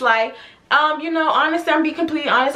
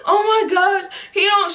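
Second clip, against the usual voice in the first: high-pitched imitated sobbing complaint; mock crying; 7 semitones higher than usual. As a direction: wail it to a friend, hammed-up. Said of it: "she starts crying".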